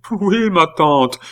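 high, slow, falling wail; sobbing reply. A narrator's reading. Nephew admitting it to his aunt, sobbing. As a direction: answer in a loud acted boo-hoo. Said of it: "sanglots de Pitou se changèrent en hurlements".

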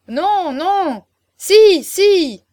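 high, loud clamour; roaring chorus. A narrator's reading. Guests answering Chaverny's offer to explain, a loud clamouring chorus. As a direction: shout it out like a rowdy crowd. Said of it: "gronda le chœur".

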